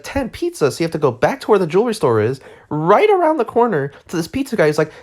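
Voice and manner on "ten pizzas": high, disbelieving echo of ten pizzas; can't believe the price; incredulous. Retold or imitated. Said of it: "And you're like".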